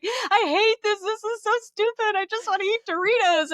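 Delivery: high, tearful wail of frustration; crying whine. Retold or imitated. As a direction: whimper it like a frustrated crier near tears. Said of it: "and I'm crying".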